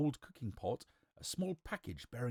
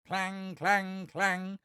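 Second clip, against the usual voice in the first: high, ringing monotone clangs; clanging pot; 8 semitones higher, 4 dB louder, much slower than usual. A narrator's reading. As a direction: imitate a metal pot clanging, pitched up. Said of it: "went the cooking pot".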